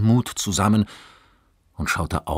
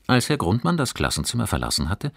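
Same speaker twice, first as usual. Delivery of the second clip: plain reading voice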